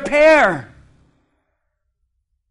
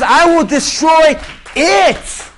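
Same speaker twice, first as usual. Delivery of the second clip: proclaimed; 4 semitones higher, slower than usual. Acted. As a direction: let each word land like a verdict. Preacher proclaiming God's promise.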